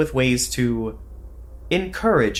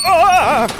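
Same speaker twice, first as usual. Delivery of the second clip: high loud yell of falling; startled yell; 9 dB louder than usual. Acted. Agent slipping on the wet floor, loud yell. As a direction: cry out as you slip.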